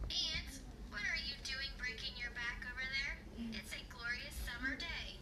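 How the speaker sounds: quiet recorded playback; played back recording. Shown as a demonstration. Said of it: "Now I'm gonna listen to it".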